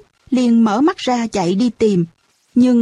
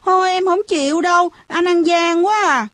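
put-on high, whining child's protest; pitched-up childish objection; 7 semitones higher than usual. A narrator's reading. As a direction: complain like a cheated little kid. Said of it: "nó giãy nảy".

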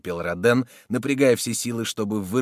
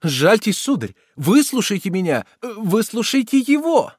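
high, urgent entreaty; pleading; 11 semitones higher, 7 dB louder than usual. A narrator's reading. Pale woman imploring the soldier, pleading. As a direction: beg him loudly to listen. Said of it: "стала молить Дагобера".

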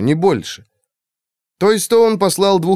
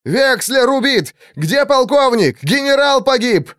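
panicked yelling; 11 semitones higher than usual. A narrator's reading. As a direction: wail the bad news. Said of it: "Они вопили".

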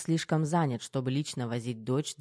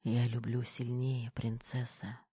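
slow, soft, weak murmur; hushed, fading tenderness; whispered; 2 semitones lower, 7 dB quieter, slower than usual. A narrator's reading. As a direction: whisper it slowly from dry lips. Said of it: "шепнул Кир".

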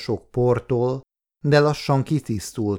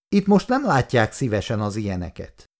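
usual speaking voice

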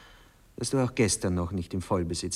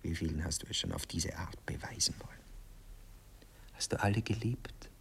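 whisper; 3 semitones lower, 6 dB quieter than usual. Acted. Jealous questions whispered in bed.

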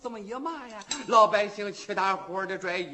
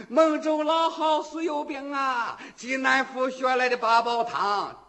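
vendor hawking; 4 semitones higher, 5 dB louder than usual. Acted. Street vendor hawking soup.

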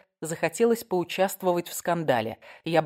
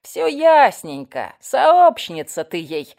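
loud, slow, drawn-out accusation; nasal old busybody; whining nasty granny; 8 dB louder, slower than usual. A narrator's reading. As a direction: stretch the words with smug suspicion. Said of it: "загундосила противная бабка".

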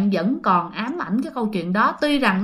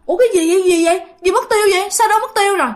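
high-pitched, baffled questioning; startled bewilderment; 8 semitones higher, much faster than usual. Acted. Mother finding the stranger vanished, startled bewilderment. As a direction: look about in alarm, voice pitched up.

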